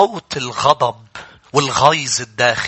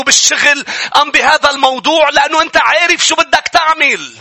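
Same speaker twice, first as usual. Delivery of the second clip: high, loud fervent sermon delivery; impassioned pulpit preaching; full-force preaching; 10 semitones higher, 5 dB louder than usual. Acted. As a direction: preach it out to the whole congregation.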